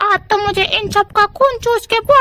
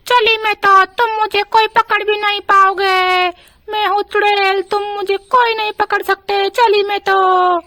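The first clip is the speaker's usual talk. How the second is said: taunting witch. Acted. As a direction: crow that no one can catch you.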